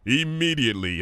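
ordinary delivery, nothing marked

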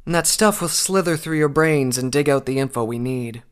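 bright, smiling menace over a nasty threat; said with a smile. A narrator's reading. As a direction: grin while you describe the horror. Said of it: "the unicorn said with a smile".

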